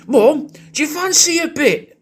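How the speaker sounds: high mock shout up to mum; shouting up the stairs. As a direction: call it up the stairs in a high put-on son voice. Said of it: "your son's shouting up the stairs to you going".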